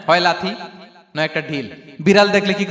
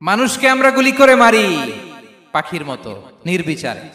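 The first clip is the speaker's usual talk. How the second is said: loud, raised preaching voice; indignant outcry; 5 dB louder than usual. Real, not acted. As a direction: cry it out to the crowd. Line repeated in indignant preaching.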